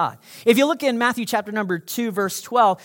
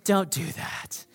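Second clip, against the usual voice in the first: soft warning; 3 semitones lower, 7 dB quieter, slower than usual. Acted. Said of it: "And God's like".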